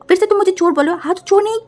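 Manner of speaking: high loud mocking insult; heated loud taunting; angry insult. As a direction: sneer at her loudly. Quarrel, insulting.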